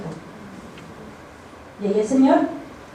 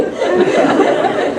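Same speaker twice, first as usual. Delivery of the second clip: big airy laugh, higher; loud laugh; 7 semitones higher, 7 dB louder than usual. Real, not acted. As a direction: burst out laughing. Laughs after the confession. (carcajada fuerte)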